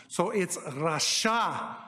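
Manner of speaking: rasha said slowly with open ah sounds; Hebrew pronunciation rasha. Shown as a demonstration. Teacher sounding out rasha letter by letter. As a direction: draw out rasha. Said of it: "it's also an ah sound".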